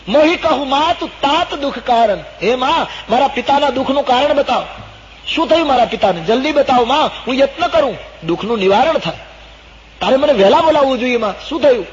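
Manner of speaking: very high, loud entreaty; urgent pleading. Acted. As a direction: implore a mother for answers. Ram urgently begs mother for answer.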